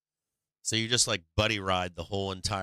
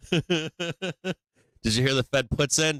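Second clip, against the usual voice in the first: laughing taunt; 7 semitones higher, 4 dB louder than usual. Real, not acted.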